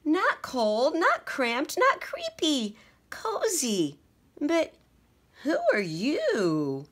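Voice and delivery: high mouse voice, rising to a puzzled question; a contented mouse suddenly startled; squeaky and curious. A narrator's reading. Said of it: "said Mortimer".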